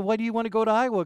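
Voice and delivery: quick mock surprise to the little girl; puzzled question. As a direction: rattle off a mild bemused question. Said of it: "And I said".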